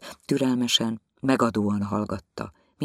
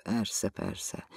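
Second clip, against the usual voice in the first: mumbled under the breath, much softer; muttered; 11 dB quieter than usual. A narrator's reading. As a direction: mutter it to yourself. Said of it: "ez dünnyögte". (halkan, az orra alatt dünnyögve)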